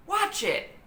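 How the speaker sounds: high, sharp warning shout. A narrator's reading. Jack shouts as the monkey throws fruit. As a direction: warn her as fruit flies. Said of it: "shouted Jack".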